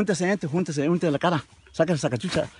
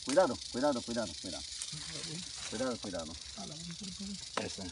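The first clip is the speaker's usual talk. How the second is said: quiet; 7 semitones lower, 6 dB quieter than usual. Real, not acted. Handling snake, soothing low warnings.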